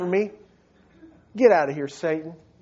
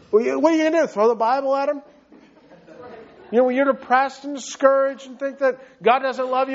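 ordinary talk

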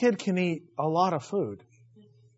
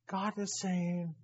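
usual speaking voice

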